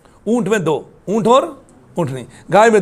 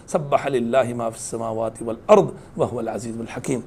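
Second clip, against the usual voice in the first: lower, softer chanted Arabic recitation; Quranic recitation; 7 semitones lower, 7 dB quieter than usual. Acted. Preacher reciting a verse to the congregation, low and chanted.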